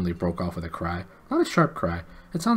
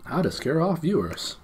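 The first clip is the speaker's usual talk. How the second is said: high, mock-dramatic title read; mock announcement; 7 semitones higher than usual. Shown as a demonstration. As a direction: announce it like a cheesy video title. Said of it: "that is how you scare off viewers".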